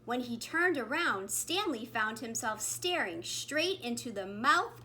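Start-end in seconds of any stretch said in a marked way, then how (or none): none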